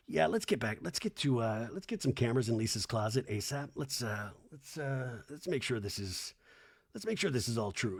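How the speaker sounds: low, soft aside to the crew; hushed producer's note. Pretend. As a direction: murmur it low, as if quietly plotting. Acting out a producer's mental note.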